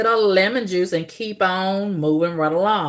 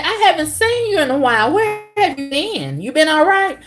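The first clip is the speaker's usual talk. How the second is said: high-pitched, quick imitation of a friendly worker; retold concern, voiced up high; concerned greeting; 9 semitones higher than usual. Store employee asking where she's been, high-pitched concern.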